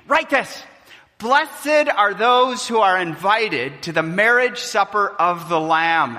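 loud ringing preacher's proclamation of the angel's words; proclaimed angel command. Acted. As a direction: announce it like a herald. Angel's words read aloud.

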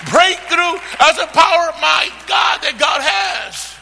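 loud, high, driving proclamation; fervent preaching. Real, not acted. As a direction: preach it out at full force. Preacher at full force.

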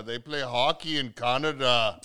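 put-on slow, loud Canadian accent. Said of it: "That's my Canadian accent".

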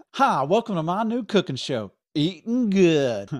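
host intro. Acted. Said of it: "That doesn't sound right either".

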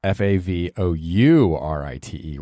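normal talking voice

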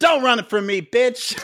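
high-pitched hammed-up taunt; mock confrontation. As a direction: play at calling out a fleeing rival. Acting out the catfight.

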